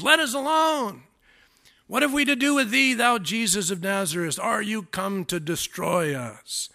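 high-pitched outburst of a demon, easing down; demon bursting out. Acted. Demon interrupts Jesus teaching in the synagogue, high outburst. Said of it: "this demon actually just burst out loud".

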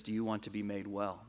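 soft, quiet, tender question; Jesus asking gently. Acted. Jesus softly asking the sick man by the pool. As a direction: ask it softly, like an offered hand. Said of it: "Jesus turns to this man and says".

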